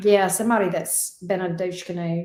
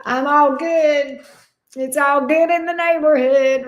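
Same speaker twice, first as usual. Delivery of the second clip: playful quip; 5 semitones higher, 5 dB louder than usual. Acted. Playful quip then laughing.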